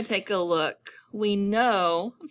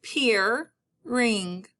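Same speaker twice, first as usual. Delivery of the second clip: PEERING split into "peer" and "ring"; syllable split. Shown as a demonstration. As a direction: pause inside "Peering", second part starting on r. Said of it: "say your word".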